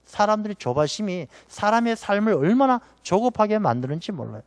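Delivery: ordinary talk, nothing marked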